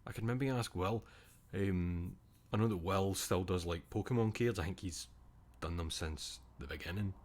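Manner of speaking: usual speaking voice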